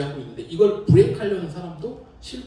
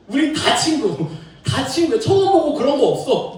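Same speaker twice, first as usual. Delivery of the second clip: higher, louder joyful chant; street party excitement; 9 semitones higher, 7 dB louder than usual. Retold or imitated. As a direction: celebrate like strangers hugging in the street. Reliving street celebration. (높고 크게 들뜬 응원 목소리)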